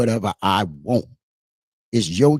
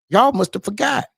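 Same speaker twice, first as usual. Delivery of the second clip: higher-pitched borrowed catchphrase; Roy Jones quote; 9 semitones higher than usual. Retold or imitated. Quoting Roy Jones to the doubters, teasing callback. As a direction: toss out the boxer's catchphrase, pitched up. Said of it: "As Roy Jones said years ago".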